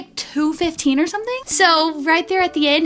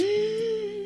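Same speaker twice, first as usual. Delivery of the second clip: gasp; 7 semitones higher, 8 dB quieter than usual. Retold or imitated. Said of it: "now I'm actually kind of scared".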